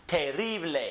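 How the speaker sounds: terrible as teh-RREE-bleh; Spanish pronunciation. Shown as a demonstration. Teacher models a cognate for repetition, terrible as teh-RREE-bleh. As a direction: stress the RRI in Spanish terrible. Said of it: "This one is pronounced".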